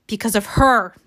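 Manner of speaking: restrained angry accusation. Acted. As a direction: put the blame hard on the last word. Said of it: "says Peeta angrily".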